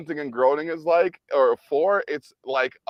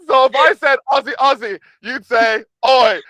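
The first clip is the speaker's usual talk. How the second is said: excited retelling; 12 semitones higher, 10 dB louder than usual. Retold or imitated. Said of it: "And I'm like".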